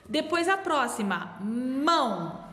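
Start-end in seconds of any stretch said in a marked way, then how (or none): 1.4-2.4 stretched Ó, mão with one opening; slow single syllable. Shown as a demonstration. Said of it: "Eu só abro a boca uma vez só".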